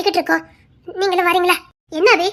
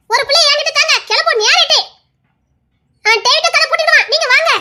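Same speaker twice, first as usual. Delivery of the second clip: raised, higher voice calling the household; 6 semitones higher than usual. Acted. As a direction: hurry everyone out the door. Hurrying the family to leave.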